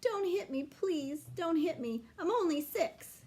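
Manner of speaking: high, pleading, near tears; crying plea. A narrator's reading. Small boy begging the older girl, high and tearful. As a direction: beg not to be hit, voice going high. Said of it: "Daniel started to cry".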